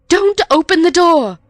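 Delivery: loud high panicked cry. A narrator's reading. Missus Stevens warns the girls, crying out in panic. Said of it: "cried Missus Stevens in a panic".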